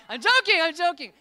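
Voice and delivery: high, loud insistent backpedal; laughing protest. Real, not acted. Pastor telling the dismissed group he's joking, high and loud. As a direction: cry out that it's a joke.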